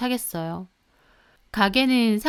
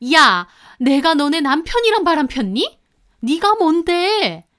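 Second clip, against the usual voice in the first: much higher and louder angry shouting; daughter-in-law yelling at the mistress, much higher and louder; furious yell; 7 semitones higher, 8 dB louder than usual. Retold or imitated. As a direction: scream it at her across the counter. Heated quarrel with the mistress.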